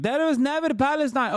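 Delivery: high mocking quote in an Arabic accent. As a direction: parrot the guest with a hammed-up Arabic accent. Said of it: "it's just like".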